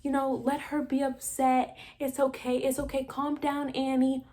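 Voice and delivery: high, softened mock-soothing voice; imitated soothing therapist. Therapist placating the upset wife, mock soothing. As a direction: coo it high, placating and put-on. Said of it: "the therapist, is like".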